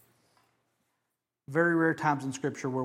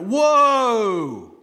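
loud high startled whoa; imitated whoa; 7 semitones higher, 11 dB louder than usual. Crowd imagined reacting to God's voice, loud startled whoa. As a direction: gasp it out in amazement. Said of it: "people went".

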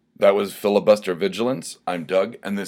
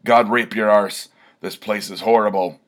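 Filibuster roaring; 3 semitones higher, 7 dB louder than usual. Acted. Barbarian hero hates this place, loud bellow. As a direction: bark it out like a disgusted warrior.

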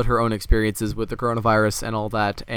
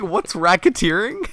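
mocking imitation; 7 semitones higher, slower than usual. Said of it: "He's like".

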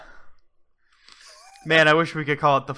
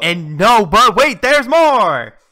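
louder, higher sales pitch; infomercial hype; 5 semitones higher, 7 dB louder, much slower than usual. Pretend. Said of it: "throughout the entire advertisement".

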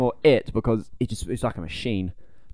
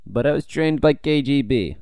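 put-on Russian accent; 4 semitones higher, 5 dB louder than usual. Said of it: "why can't I do the accent anymore?".

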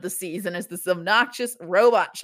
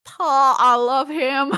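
loud, higher, badly faked Scottish; terrible Scottish accent; 5 semitones higher, 5 dB louder, much slower than usual. Robot Clank imagined crushing someone, in a terrible Scottish accent. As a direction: attempt a Scottish accent and break up laughing. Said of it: "That was a terrible Scottish accent".

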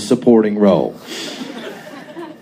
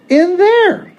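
her voice. A narrator's reading. Her voice, very high.

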